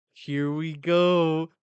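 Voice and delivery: really savoring the moment as it builds. Real, not acted.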